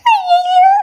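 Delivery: loud, high alarmed wail; panicked cry. Acted. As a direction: cry out in sudden fright. Panic as brother arrives.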